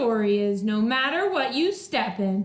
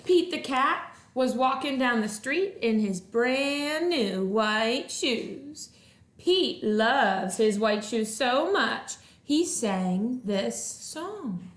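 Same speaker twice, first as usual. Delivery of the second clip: lively storybook voice for first graders; reading to children; 5 semitones higher, slower than usual. Acted. Teacher reading a picture book to her first grade class, animated. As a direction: read it like a teacher at story time.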